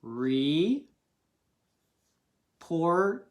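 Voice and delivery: Report-ing drawn out, slowly, syllable by syllable; very slow sounding out. Shown as a demonstration. Teacher sounding out Report-ing very slowly. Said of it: "pause it and try reporting".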